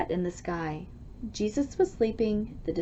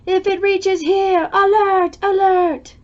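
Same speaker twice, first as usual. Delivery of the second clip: loud, high warning cry; alarm call; 10 semitones higher, 8 dB louder than usual. Pretend. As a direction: sound the alarm like a siren. Windometer reaches ninety percent.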